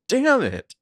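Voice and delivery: loud, very high acted shout of frustration; furious outburst. Arya as her phone burns, acted furious outburst. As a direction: explode in imitated fury. Repeats Arya's outburst that she loved.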